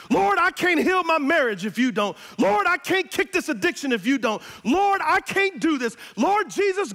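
mock ugly-cry prayer; desperate prayer. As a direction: beg the Lord like you're at the end. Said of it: "You need an ugly face cry".

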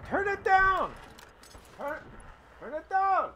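high shrill-free mock yell of mom over the TV. Mom yelling over blaring TV.

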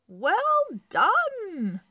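high, loud cheering praise; excited praise. Acted. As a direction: cheer him on warmly. Coach praising goalie.

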